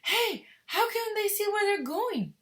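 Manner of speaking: high, loud, slow frightened shriek. Acted. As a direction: cry out in the dark, scared. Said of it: "shrieked Violet Evergarden in the darkness".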